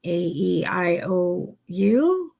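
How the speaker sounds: a nervous client who checks if it's right; awkward, rising, unsure vowels. Retold or imitated. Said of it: "I would be like".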